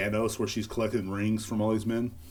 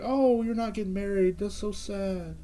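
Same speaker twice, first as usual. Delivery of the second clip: much higher, fake sympathetic coo; mock pitying fans; 10 semitones higher than usual. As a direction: coo in fake pity like a fan. Mocking fans pitying breakup.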